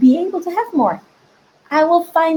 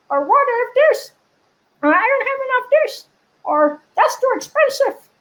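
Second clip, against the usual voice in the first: very high, squeaky character voice; little voice; 12 semitones higher than usual. Acted. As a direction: voice the doubting inner critic in a high squeak. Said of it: "this little voice comes up".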